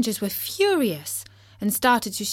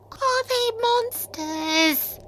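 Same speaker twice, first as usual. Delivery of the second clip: gasp, then very high, slow question; small frightened squeak; timid; much slower than usual. A narrator's reading. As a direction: ask it fearfully, drawing out each word. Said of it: "asked Tim timidly".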